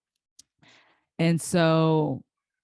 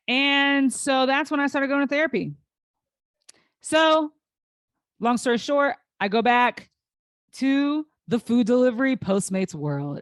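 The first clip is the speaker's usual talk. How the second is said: pushing through emotion; 9 semitones higher, 5 dB louder than usual. Real, not acted. Emotional recovery, voice pitched up.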